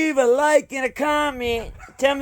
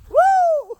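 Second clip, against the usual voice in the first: high whooping cheer; excited whoop. Real, not acted. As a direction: let out a gleeful woo.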